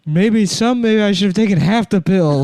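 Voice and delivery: grandpa spiraling through gross memories; manic grandpa. Acted. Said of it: "grandpa rambling".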